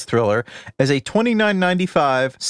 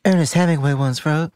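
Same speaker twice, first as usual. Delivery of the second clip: movie ending quote; 3 semitones higher than usual. Retold or imitated. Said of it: "I'm gonna do the end of Seven".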